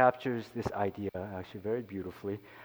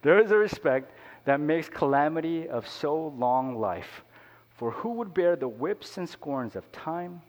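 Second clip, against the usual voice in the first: declaimed Shakespeare, slowed in places; earnest reading of Hamlet to the congregation; poem recitation; 3 semitones higher, 3 dB louder than usual. Acted. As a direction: recite Hamlet like a stage soliloquy.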